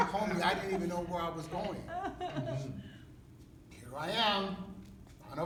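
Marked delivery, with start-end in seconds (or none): none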